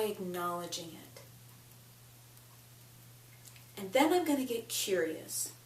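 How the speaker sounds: deliberate phrases, long pauses between each; tapping script. Acted. Coach voicing affirmations for listeners to repeat, measured and unhurried.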